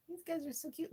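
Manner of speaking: high, soft squeaky coo; cutesy high voice. Real, not acted. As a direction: gush quietly in a tiny voice. Cooing over toys.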